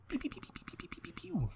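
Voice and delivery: high unvoiced pew pew shots; pew pew shooting. Shown as a demonstration. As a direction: fire off quick breathy pews like a nail gun. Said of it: "That was me shooting a nail gun".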